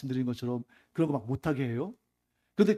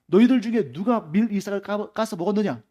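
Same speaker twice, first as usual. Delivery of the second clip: imitated rebuke, higher and louder; mock scolding; 5 semitones higher, 6 dB louder than usual. Teacher imagined telling off his disciples, mock scolding. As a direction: scold as a retold stern outburst. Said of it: "막 호통치시면서".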